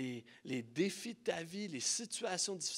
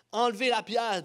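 loud, raised order; firm command; 5 semitones higher, 8 dB louder than usual. A narrator's reading. Preacher reads Jesus's order at the tomb, commanding. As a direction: call it out as a command.

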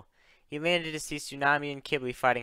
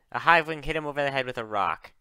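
ordinary delivery, nothing marked

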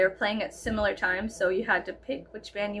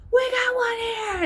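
1 calling out; 13 semitones higher, slower than usual. Retold or imitated. Bouncer signals to crowd.

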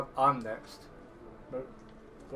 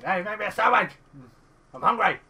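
loud, higher hammed-up demand; mock bossy shout; 5 semitones higher, 8 dB louder than usual. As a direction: yell it like a spoof bossy husband. Mock demand barked at kitchen.

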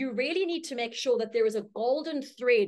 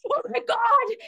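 much higher and louder, over-the-top delight; gushing Jane; 14 semitones higher, 7 dB louder than usual. Retold or imitated. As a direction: squeal it like she's thrilled to see you. Jane overjoyed, hugs you.

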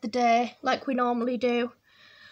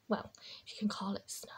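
quick wry whisper; 5 semitones lower, 12 dB quieter, much faster than usual. Real, not acted. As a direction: mutter it as a joke. Wry aside.

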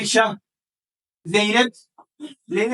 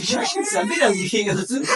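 high, sharp teasing yell; exasperated shout; 4 semitones higher, slower than usual. Real, not acted. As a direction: shout a playful scolding. Shouting teasingly at slow child.

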